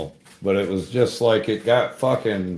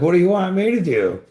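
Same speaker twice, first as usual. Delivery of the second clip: higher, quick mock of a helpless coworker; imitating Jordan; 9 semitones higher, 4 dB louder, faster than usual. Coworker Jordan watching him dangle: quick, high imitated helplessness. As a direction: toss it back, fast and high, at a loss. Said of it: "He's like".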